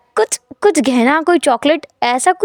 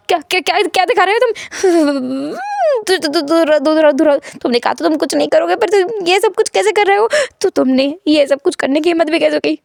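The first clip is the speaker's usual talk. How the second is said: panicked shriek; 3 semitones higher than usual. Acted. Wing recoiling in shock at what he sees, panicked. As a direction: stammer it out in shocked alarm. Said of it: "wing इतनी ज़ोर से चिल्लाता है".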